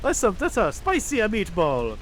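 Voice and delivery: put-on high-pitched comic Italian accent; hammed-up squeaky Italian dismay; cartoon Italian fireman, dismayed. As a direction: lament it in a silly high Italian voice.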